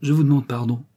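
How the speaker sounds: grudging apology with a pout; boy forced to apologise, sulking; sulky. A narrator's reading. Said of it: "dit-il d'un ton boudeur".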